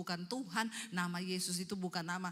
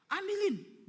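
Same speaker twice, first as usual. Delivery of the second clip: high, loud acted command; shouted demand; 11 semitones higher, 6 dB louder, slower than usual. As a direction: yell an order as the possessed husband. Suami kerasukan menyuruh istrinya. (perintah sambil berteriak)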